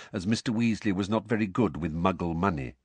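plain reading voice

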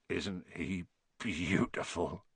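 low, slow, hushed wonder; murmur. A narrator's reading. Hagrid gazing at the baby dragon, tender murmur. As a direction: murmur it softly and slowly. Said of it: "Hagrid murmured".

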